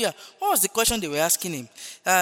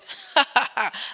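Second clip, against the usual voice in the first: real rolling chuckle; hearty laugh; 2 semitones higher than usual. Real, not acted. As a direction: laugh out at the absurdity. Laughs at his own retort.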